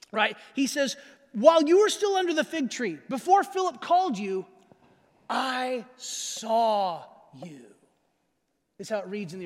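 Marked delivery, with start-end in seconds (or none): 1.3-7.7 "I saw you" held back and stretched very slow at the end; emphasis on last words. Shown as a demonstration. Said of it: "having it first or last is a place of emphasis".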